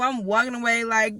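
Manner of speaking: normal talking voice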